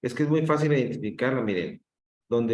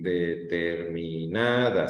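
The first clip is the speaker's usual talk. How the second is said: "determinada" drawn out, lifting on "na"; word stretched by syllable; 4 semitones lower, slower than usual. Shown as a demonstration. Teacher showing the word has five syllables, "determinada" stretched out. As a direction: stretch every syllable of de-ter-mi-NA-da. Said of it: "sería cinco sílabas".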